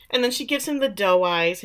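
ordinary talk, nothing marked

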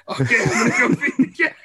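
imitating the groggy son through laughter; laughing imitation. Dad imitating his groggy son, laughing mimicry. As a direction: mimic the sleepy kid while cracking up.

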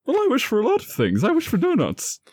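put-on high, fast, childish pleading; high childish voice asking for donuts; whiny wish. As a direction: beg like a kid for donuts. James asks for donuts, high.

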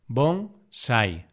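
bonsai split into bon, sai; syllable by syllable. Shown as a demonstration. Teacher dividing bonsai for learners, syllable by syllable. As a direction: say "bonsai" with a pause between syllables.

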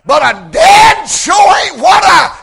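rising testimony that builds to a loud shout on the last line; preaching at full force. Acted. Preacher testifying to the congregation, building to full shout.

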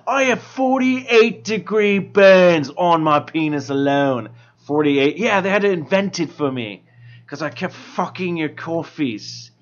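lazy self-satisfied barista; bored ironic hipster. Acted. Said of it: "that's my hipster voice".

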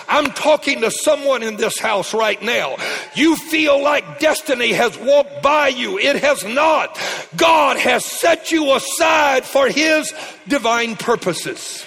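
high, loud and slow, building to a shout; full-force preaching. Real, not acted. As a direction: drive it home to the congregation. Preaching climax.

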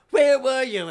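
high, very loud mock demand; indignant shout. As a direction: holler like an impatient flamboyant host. Impatient host confronts late guests.

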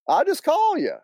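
put-on high, thick North Carolina accent; Southern accent running the words together. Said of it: "he replied in a thick accent".

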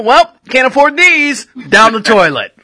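high, sing-song mock voice with meows; hammed-up careless pet owner; mocking cat killer. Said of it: "I thought you were like".

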